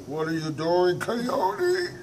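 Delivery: high, loud suspicious monster voice; monster asking. Acted. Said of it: "The monster said".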